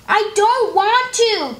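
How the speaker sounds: high, slow, sulky refusal; stubborn little girl. Acted. Little Beatrice refuses her brother at the library, high slow whine. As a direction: whine it out like a reluctant kid. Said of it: "Beatrice told him".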